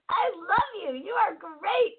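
high, sing-song I love you; gushing. Retold or imitated. Wife gushes high at husband after his compliment. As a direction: gush back at him sweetly.

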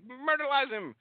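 high cartoonish hype man; Heyman cartoon voice. Impersonating Heyman urging Lesnar, cartoon squeal. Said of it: "Heyman's all like".

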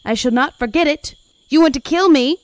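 loud, high accusing cries; high-pitched, forceful outburst; screaming patient. Acted. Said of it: "another screaming at some nurse".

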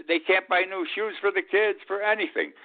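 high, breaking into laughter mid-sentence; can barely get the words out for laughing; laughing while talking. Real, not acted. Host laughing at money woes on air. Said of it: "I'm, I'm laughing".

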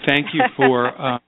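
real chuckle breaking up the thanks; chuckling. Real, not acted. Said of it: "I'm chuckling because my inner critic".

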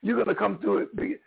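normal talking voice

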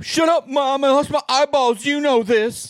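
high, loud whiny brat; whiny teen son. Pretend. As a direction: whine it up high at Mom. Whiny son answering mom.

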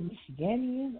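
"Michiganian" as mish-i-GAN-ian; slow "Michiganian", short a in GAN; alternative pronunciation. Shown as a demonstration. Caller asks what it's called, "Michiganian" as mish-i-GAN-ian. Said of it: "what the hell is it called?".

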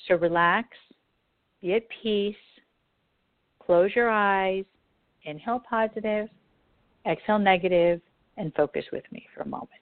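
calm, unhurried meditation voice; guided meditation. Acted. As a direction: lead them gently into calm. Leads a meditation.

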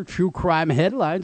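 usual speaking voice

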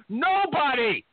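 ordinary talk

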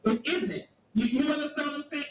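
normal talking voice

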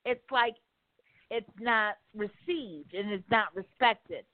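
ordinary delivery

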